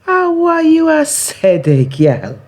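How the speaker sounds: high, drawn-out patois taunt; teasing neighbour. Acted. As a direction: tease her like a nosy market neighbour. Neighbour's voice in poem.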